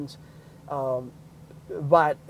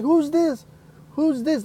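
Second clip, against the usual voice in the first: a hint of a suspicious mom; 9 semitones higher, slower than usual. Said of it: "Mom is like".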